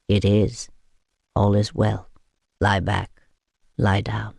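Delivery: gently quiet her down; soothing hush. A narrator's reading. Vampire hushes the weeping woman, soothing.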